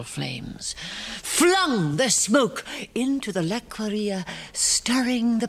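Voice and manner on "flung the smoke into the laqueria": ordinary delivery, nothing marked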